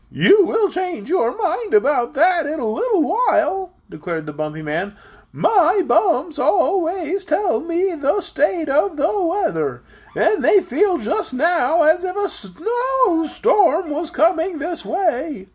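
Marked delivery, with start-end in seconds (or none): 0.1-3.7 high, slow voice bobbing up and down; bumpy voice. A narrator's reading. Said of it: "said in a rather bumpy voice". 5.3-15.5 high, slow voice bobbing up and down; bumpy voice. A narrator's reading. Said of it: "said in a rather bumpy voice".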